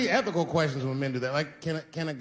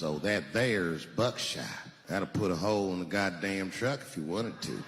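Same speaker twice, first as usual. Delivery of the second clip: slow gun shop owner; 8 semitones lower, 5 dB quieter, slower than usual. Retold or imitated. Gun seller voiced in story.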